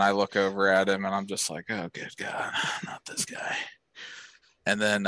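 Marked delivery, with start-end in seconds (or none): 1.7-3.6 whispered aside, acted weary; soft, whispered acted groan of dread; dismayed whisper. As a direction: breathe it to yourself in mock despair. Acts muttered dismay at newcomer.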